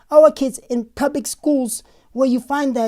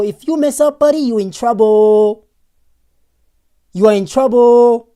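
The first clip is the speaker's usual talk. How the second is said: stern warning; 7 dB louder than usual. Real, not acted. Streamer warns lawbreakers to his chat, loud and stern. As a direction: warn them firmly, spelling it out.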